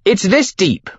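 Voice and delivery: high-pitched yell back to the group; yelled call. A narrator's reading. Tarkin to his team from midriver, hands cupped, yelling. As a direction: call it out high, as if across water. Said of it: "he yelled back to the team".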